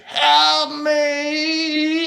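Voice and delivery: very high, full-force screams for help; tortured screaming. A narrator's reading. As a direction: scream in agony, pleading over a radio. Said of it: "screaming sounds what can only be described as torture".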